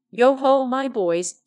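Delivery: merry employer hailing his apprentices, loud and jolly; jolly hearty call. A narrator's reading. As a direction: call them in for a holiday, beaming. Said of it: "said Fezziwig".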